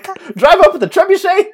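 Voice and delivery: high, loud gleeful punchline; excited. Real, not acted. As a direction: squeal the absurd image. Absurd joke, laughing.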